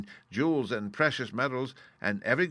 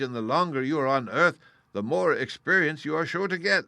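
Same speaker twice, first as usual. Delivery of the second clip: Oz voiced; 5 semitones higher, 5 dB louder than usual. Oz lecturing the Scarecrow.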